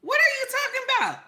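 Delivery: high, fast, laughing disbelief; incredulous squeal. Retold or imitated. Speaker retelling scoffing at her auntie, high incredulous squeal. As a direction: squeal in mock shock. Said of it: "I said".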